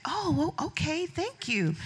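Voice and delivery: high flustered thanks. Retold or imitated. Guest flattered by Oprah, high flustered thanks. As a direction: stammer the thanks, caught off guard. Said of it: "And I was like".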